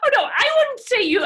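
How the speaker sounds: high, loud burst of protest. Real, not acted. Grandma protesting to granddaughter, shouting in alarm.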